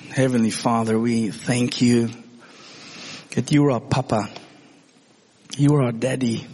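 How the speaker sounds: leading the church in prayer. Acted. Leads prayer.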